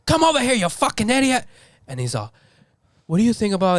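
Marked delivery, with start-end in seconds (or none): 0.1-1.4 high, yelled chef insult; angry chef. Retold or imitated. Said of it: "his insults also come off scary because he's like". 3.1-3.8 monotone calm. Retold or imitated. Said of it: "very monotone, like, calm voice".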